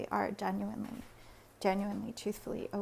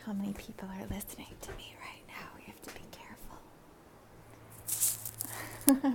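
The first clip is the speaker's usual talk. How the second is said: quick hushed aside, dropping towards a whisper; hushed, wary; 3 dB quieter, much faster than usual. Real, not acted. Wary of being overheard at home.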